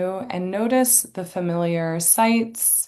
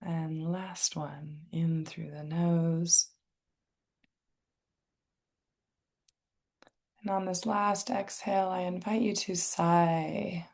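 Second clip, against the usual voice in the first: calm, unhurried meditation guide, long pauses; guided meditation; 5 dB quieter than usual. Real, not acted. Inviting listeners to tune into senses and breath, guided meditation. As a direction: softly guide listeners into relaxation.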